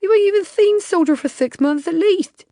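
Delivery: high, loud indignant protest; indignant Larry. A narrator's reading. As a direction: argue back, outraged and loud.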